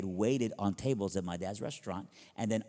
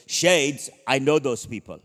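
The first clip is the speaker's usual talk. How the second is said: loud call from the bedroom; dad yelling; 3 semitones higher, 9 dB louder, slower than usual. Retold or imitated. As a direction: boom it back, recognizing the name. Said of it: "my dad yells really loud".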